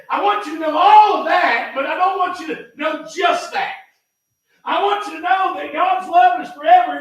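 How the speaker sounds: loud, high, fervent sermon climax; full-force preaching. Acted. Preacher to congregation, shouting that God's love is forever.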